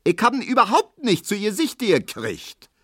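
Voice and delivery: high, indignant grandmother squawk; shrill, scolding protest; nagging protest. A narrator's reading. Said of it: "zetert Frau Neuendorf".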